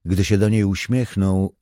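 usual speaking voice

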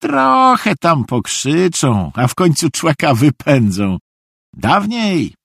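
high, easygoing naive voice; contented high-voiced fool; good-natured simpleton. A narrator's reading. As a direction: tell cellmates it is nothing, high-pitched. Narrator voices Szwejk lecturing cellmates.

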